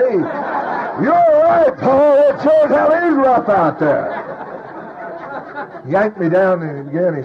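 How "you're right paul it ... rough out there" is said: hammed-up agreement. Calling out agreement mid-meeting.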